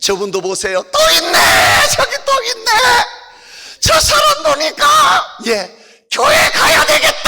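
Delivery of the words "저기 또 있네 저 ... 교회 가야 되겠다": high, bold little puppet boasting. Acted. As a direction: brag proudly as the puppet doll. Puppet points out people. (당당하게 자랑하는 높은 인형 목소리)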